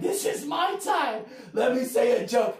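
slow, high, loud insistence; pleading retort. Retold or imitated. Comic pleading with the booing crowd, loud slow insistence. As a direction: insist loudly on your time. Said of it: "I'm like".

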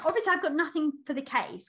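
ordinary talk

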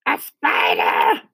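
slow, rough horrified shriek; acted outcry of a disgusted aunt; Aunt Sponge crying out. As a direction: cry out in horror at a spider. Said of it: "she cried".

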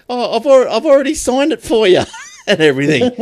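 high-pitched imitated protest breaking into laughter; laughing mock protest. CD seller pushing an already signed disc, laughing mock protest. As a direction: mimic the indignant seller through laughs.

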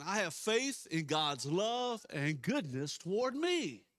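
slow, loud leading of a congregation; declaration of faith. Real, not acted. Pastor leading a repeated confession of faith, bold declaration. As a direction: declare it boldly, word by word.

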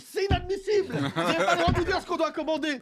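high-pitched outraged protest, laughing; hammed-up indignation at the waiter; indignant outburst. Retold protest at the bar.

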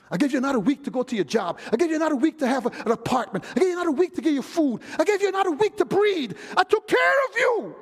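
quick repeated reproach climbing to a high shout; building divine reproach. Acted. God listing his gifts to the ungrateful, rising to a shout.

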